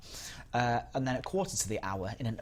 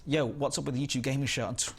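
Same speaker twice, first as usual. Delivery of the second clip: usual speaking voice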